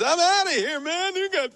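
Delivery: high squeaky mock voice of a fleeing burglar; panicked burglar. Burglar fleeing the security drone, high mock panic. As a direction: squeal it like a spooked crook bolting for the door. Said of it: "and he goes".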